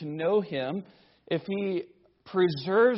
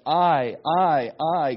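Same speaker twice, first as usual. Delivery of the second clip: I, I, I, each hit hard and louder; emphasis on I; 7 dB louder, slower than usual. Shown as a demonstration. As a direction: hammer each I home. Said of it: "Notice the emphasis".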